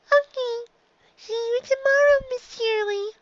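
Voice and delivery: put-on high, small child's voice; little filly voice. Dicky says goodbye to teacher.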